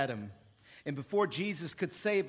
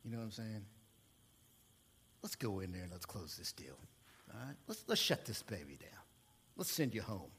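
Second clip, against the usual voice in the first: softer, smooth, fast sales patter dropping to a hush; car salesman; 7 semitones lower, 5 dB quieter than usual. Retold or imitated. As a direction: ease the customer into the deal. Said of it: "as a car salesman, I used to say".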